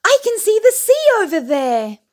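high, loud thrilled call; excited shout. A narrator's reading. Little bear shouting to Daddy that he sees the sea, high and loud. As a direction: shout out like you spotted treasure. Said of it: "Little Bear shouted".

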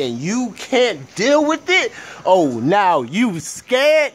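high, loud furious outburst; angry rant. Real, not acted. Ranting about his brother who taught him conspiracies, furious shout. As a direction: let the anger at your brother explode.

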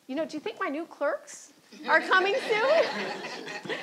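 a dry hint delivered through laughter; laughing mock Justice.

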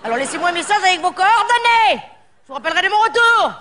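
high, snappy voicemail greeting; irritated greeting. Acted. Annoyed at callers, scolding them in a high greeting. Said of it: "je les engueule un petit peu".

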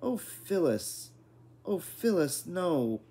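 high pleading wail, falling on each phrase; squeaky, despairing. A narrator's reading. Father begging Phyllis to spare the squirrel, squeaky despair. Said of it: "in a squeaky, despairing voice".

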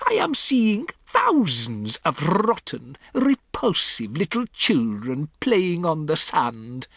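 put-on high, building accented tirade, easing at the end; theatrical loathing with rolled r; disgusted witch rant. A narrator's reading. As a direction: relish the revulsion in every adjective. Witch rants in disgust about children.

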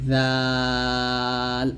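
"জাল" with thin voiced th and very long vowel; tongue at teeth tips, stretch "জাল" as dhaal; thin dhal. Shown as a demonstration. Teacher models dhal from its makhraj, thin and long. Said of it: "জাল পাতলা করে উচ্চারণ".

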